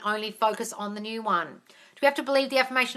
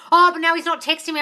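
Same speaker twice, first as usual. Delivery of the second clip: mock panic, a little higher and louder; panicky client; 7 semitones higher, 8 dB louder than usual. Coach mocking a panicky client's text worries, higher and louder. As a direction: imitate a client freaking out over a text. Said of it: "freaking out and saying".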